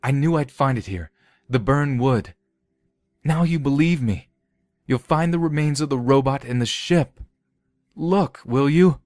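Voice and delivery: high, hushed insistence; urgent pleading. Acted. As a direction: press them to believe you. Olam pleading with the armed men.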